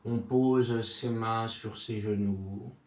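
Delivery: soft, low meditation voice with long silences; guided body scan. Acted. Sophrology guide takes listener from forehead to chest, soothing guided relaxation.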